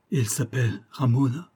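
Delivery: plain reading voice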